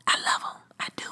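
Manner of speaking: confiding whisper, voiceless; whispered aside. Acted. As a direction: lean in and whisper the confession. Aside about loving Netflix.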